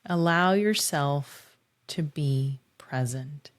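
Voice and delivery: unhurried visualization with long pauses; measured meditation voice, lingering on key words; guided meditation. Acted. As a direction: guide listeners gently inward, phrase by phrase.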